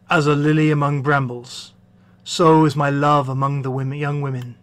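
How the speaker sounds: low, measured lover's voice; man in love. Acted. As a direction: praise her as the bridegroom.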